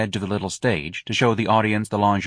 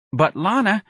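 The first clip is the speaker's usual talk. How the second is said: high-pitched plea; pleading, high dancer voice; protesting dancer; 12 semitones higher than usual. Acted.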